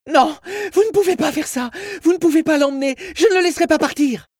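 very high, loud desperate shriek; mother screaming. Acted. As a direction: scream in refusal as they take someone away. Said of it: "Sa mère hurla de nouveau".

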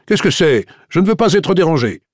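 mildly testy at being interrupted; gruff. A narrator's reading. Commissaire answering a knock at the door, gruff. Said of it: "demanda d'un ton bourru".

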